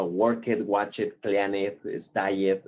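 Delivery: worked, watched, cleaned, stayed each with an added e syllable; exaggerated Spanish-style ending on worked, watched, cleaned, stayed; wrong extra e. Shown as a demonstration. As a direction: say them the wrong way with -ed as "et". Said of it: "eso no aplica".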